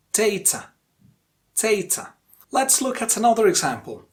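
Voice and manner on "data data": DATA with the first syllable long and strong, DA-ta; first-syllable stress. Shown as a demonstration. Teacher modelling data for learners, stressed on DA. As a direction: stretch the DA in data, let ta drop. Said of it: "the stressed syllable is the first syllable".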